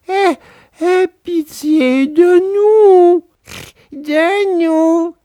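high, slow pleading whine; the fox pleads with Pinocchio, slow whine; whining fox. A narrator's reading. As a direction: beg as if starving. Fox begging.

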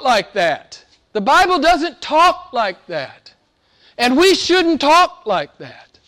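high, emphatic pulpit declaration; forceful preaching. Real, not acted. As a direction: drive it home to the congregation, pitched high.

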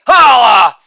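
loud exclaimed tag; big showy holler; shouted catchphrase. Acted. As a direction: belt it out as a punchline button. Shouted catchphrase after each bit.

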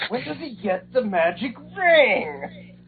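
high, slow puzzled question; clueless viewer. Retold or imitated. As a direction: play a confused fan. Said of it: "you're like".

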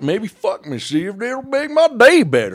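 high, loud, hammed-up challenge; riled-up [name]. [name] daring his pal in the bit, high and riled. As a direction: climb into a squealing mock taunt.

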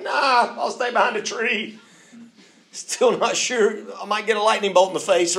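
scared Adam. Acted. Adam hiding from God, warily refusing to come out. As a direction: peep out a wary refusal from behind the tree.

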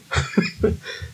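short, soft chuckle; real little laugh after speaking; amused laugh. Real, not acted. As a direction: chuckle at your own remark. Amused at own story.

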